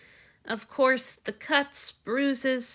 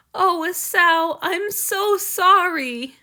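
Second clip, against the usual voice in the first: high, slow, loud and anguished; tearful apology; 8 semitones higher, 8 dB louder, slower than usual. Acted. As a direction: plead sorry through tears. Hanyu apologizing through tears.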